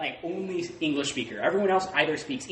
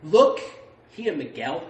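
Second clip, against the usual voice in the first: lightly voiced frowning boss; 3 semitones higher, 5 dB louder, slower than usual. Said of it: "he was frowning and he was like".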